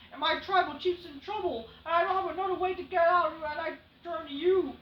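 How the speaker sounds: a touch of a desperate manager; lightly voiced begging manager; imitating Heyman. Heyman's speech continues.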